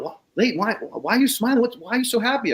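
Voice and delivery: mock puzzled wife. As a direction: act out her confusion at his grin.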